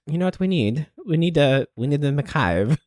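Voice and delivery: lilting put-on Swiss accent. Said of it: "That's my Swiss accent".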